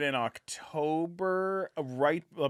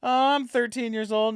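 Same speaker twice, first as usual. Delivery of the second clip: put-on teen voice; 10 semitones higher, 6 dB louder than usual. Imitating teen partygoer.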